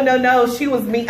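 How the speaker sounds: usual speaking voice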